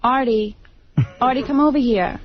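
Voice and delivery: put-on Jersey girl accent, calling out; broad New Jersey accent. Shown as a demonstration. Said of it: "Do the accent".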